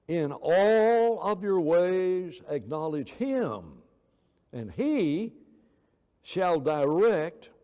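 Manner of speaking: "all" stretched long and loud, weighty pauses before "shall direct"; emphatic scripture reading. Shown as a demonstration. Preacher rereading the verse with full weight, emphatic and deliberate. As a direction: proclaim it, drawing out "all" and "direct".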